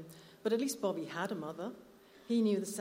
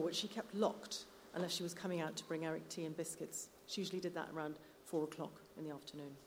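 usual speaking voice

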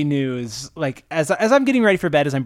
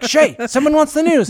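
high, loud mock call across a studio; raised, pitched-up shout; 11 semitones higher, 6 dB louder than usual. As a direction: imitate a staffer urgently summoning someone. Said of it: "and they're like".

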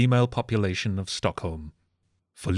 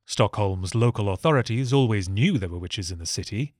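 ordinary delivery

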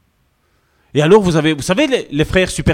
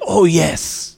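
mock churchy exclamation. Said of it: "tu les entends à la place de dire Amen".

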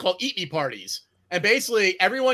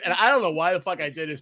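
ordinary delivery

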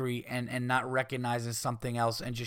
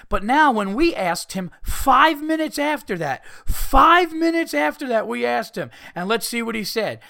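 mock the host's smug gotcha; mock Matt Lauer; 11 semitones higher, 8 dB louder than usual. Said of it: "Matt Lauer goes".

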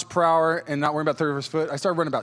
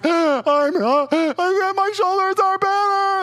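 much higher, slow, broken by sobs; weeping; 6 dB louder, much slower than usual. Retold or imitated. Telling friends his shoulders are healed, weeping much higher. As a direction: blubber it out to your friends. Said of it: "just absolutely weeping".

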